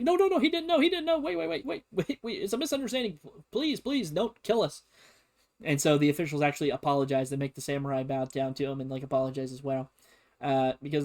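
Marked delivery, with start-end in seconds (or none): 0.0-4.8 much higher, frantic pleading; panicked diplomat. Retold or imitated. Diplomat begging Biddle not to fire, high and panicked. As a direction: squeal it out, begging for your life. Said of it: "one of the diplomats is like".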